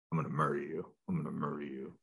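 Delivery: low, quiet killer-dog voice; aggressive dog voice. Acted. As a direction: play a menacing dog, low and soft. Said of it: "I do the voice".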